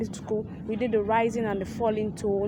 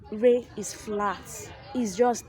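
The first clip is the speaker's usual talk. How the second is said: slow flat demo; much slower than usual. Shown as a demonstration. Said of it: "that is a nonchalant tone".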